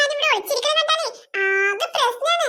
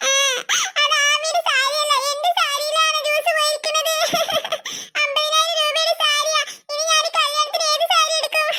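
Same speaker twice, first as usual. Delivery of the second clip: crying. Acted. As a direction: lament tearfully, breaking into wails. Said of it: "കരയല്ലേ".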